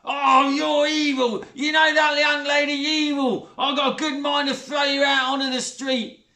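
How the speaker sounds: loud, high furious yelling; furious shout. A narrator's reading. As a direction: blast her with rage. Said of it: "even more furious".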